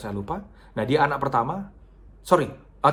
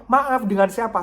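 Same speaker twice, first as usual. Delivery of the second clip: urgent question; 8 semitones higher, 6 dB louder than usual. Retold or imitated. Asking caller again.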